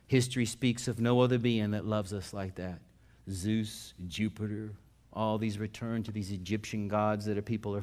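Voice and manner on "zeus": ordinary delivery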